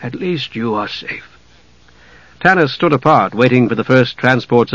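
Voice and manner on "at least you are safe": soft, choked relief; hushed, understated sorrow; grief-stricken. A narrator's reading. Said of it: "with a great sob".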